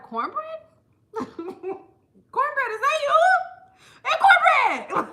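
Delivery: high, loud delighted calls to the cornbread; excited calling out. Pretend. Her neck greeting cornbread across the aisle, high excited calls. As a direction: squeal at it like spotting an old friend.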